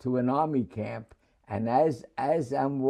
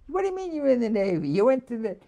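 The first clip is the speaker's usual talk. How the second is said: much higher, fast, disbelieving mother; shocked mother; 11 semitones higher, faster than usual. Retold or imitated. Mother hears her son joined the Navy: high, fast, disbelieving. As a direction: fire it back as the stunned mother.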